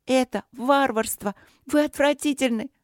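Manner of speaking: princess condemning the dragon, in high outrage; indignant outburst. A narrator's reading.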